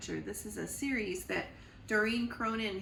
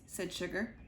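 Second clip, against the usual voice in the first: plain reading voice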